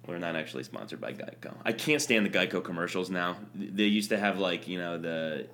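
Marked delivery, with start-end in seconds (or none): 0.1-1.5 much softer, lower throwaway aside; quiet aside. Real, not acted. Host admitting to listeners there's no sponsor, quietly. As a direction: drop it under your breath.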